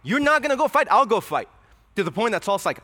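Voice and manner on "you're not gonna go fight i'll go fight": put-on eager young fighter. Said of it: "probably say".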